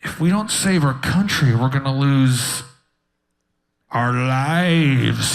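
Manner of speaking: dramatic declaration. Retold or imitated. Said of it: "I remember going".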